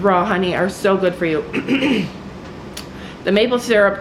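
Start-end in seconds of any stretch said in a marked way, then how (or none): 1.5-2.4 quick rough throat clear. Real, not acted. Frog in throat, clearing again.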